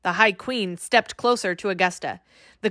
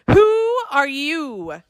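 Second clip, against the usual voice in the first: slow, high called question; a startled king calling out; 6 semitones higher, 3 dB louder, much slower than usual. A narrator's reading. Fairy king calling to intruders, lightly voiced. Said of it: "the king called".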